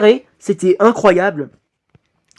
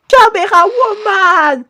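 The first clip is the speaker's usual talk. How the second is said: high, slow cartoon villain voice; high character voice; 12 semitones higher, 4 dB louder, slower than usual. Voicing a character in reaction.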